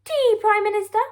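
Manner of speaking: brisk royal interruption; interrupting Queen. Acted. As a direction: cut him off with royal poise. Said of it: "interrupted the Queen".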